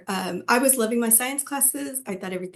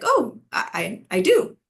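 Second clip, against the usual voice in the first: a bright, eager little yes on being asked; surprised yes; 2 semitones higher, 3 dB louder, slower than usual. Retold or imitated. Surprised to be asked about Spanish.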